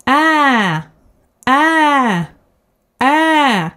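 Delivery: Ä with chin well down; ä, mouth wide open; long Swedish Ä. Shown as a demonstration. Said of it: "like that".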